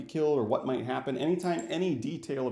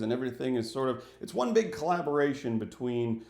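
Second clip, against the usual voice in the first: ordinary talk, nothing marked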